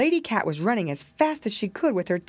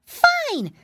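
very high, happy piggy cry; excited cry; 5 dB louder than usual. A narrator's reading. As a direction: squeal it out with glee. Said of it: "cried Flop Ear".

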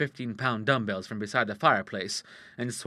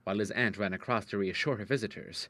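ordinary delivery, nothing marked

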